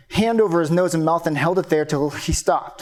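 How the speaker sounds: ordinary delivery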